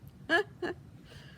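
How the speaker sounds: breathy unvoiced giggle; laugh at a slip. Real, not acted. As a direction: laugh it off after misreading. Reader laughs at own slip.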